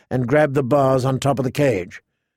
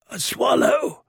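a little higher, pleading for a swallow; hint of a begging prisoner; 10 semitones higher, slower than usual. Fat caged man calls down to the girl, pleading. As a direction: reach up to her with a plea. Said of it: "the fat man called down".

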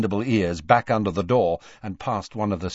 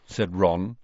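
usual speaking voice

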